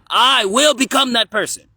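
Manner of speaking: put-on very loud, high child's declaration; kid voice. Kid repeating Yeshua's promise, loud high shout. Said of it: "you just did it in the kid voice".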